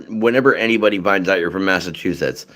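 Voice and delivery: normal talking voice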